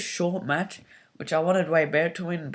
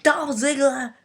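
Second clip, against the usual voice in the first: sharp, much higher, louder angry call of his name; really angry; 10 semitones higher, 6 dB louder, slower than usual. Retold or imitated. Vicky calling Dolph Ziggler over, angrily shouting his name. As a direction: bark the name out in a furious burst. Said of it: "Like, really angrily".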